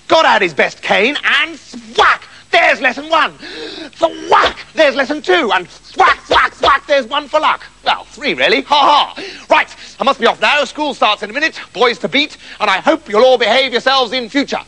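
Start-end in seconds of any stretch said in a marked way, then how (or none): 1.6-2.3 high, sharp "thwack"; voiced cane thwack. Narrator imitates cane blow. 2.5-14.6 high, relishing schoolmaster; brisk caning teacher. Acted.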